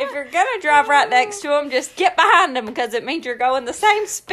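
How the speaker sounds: exasperated driver winding down from a yell; acted road-rage rant. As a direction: scold the drivers blocking the lane. Rant at drivers continues, easing off.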